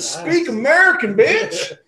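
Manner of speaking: very high, squawked mock order; hammed-up xenophobic outburst; mock demand. Joking comeback to a Southern woman, high mock demand. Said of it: "you should've said".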